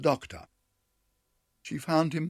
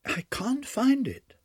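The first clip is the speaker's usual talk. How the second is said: higher, slow and worried; anxious; 5 dB louder, slower than usual. A narrator's reading. Duchess searching the pie dish, anxious. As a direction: fret over the missing thing. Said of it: "she said anxiously".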